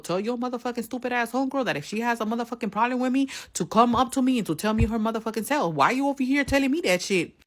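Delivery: high, fast heated challenge; retold angry challenge. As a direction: dare her to come say it herself. Retold angry message to rival.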